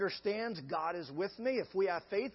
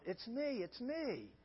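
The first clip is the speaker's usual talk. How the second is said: soft reassurance; 3 semitones higher, 8 dB quieter, slower than usual. Retold or imitated.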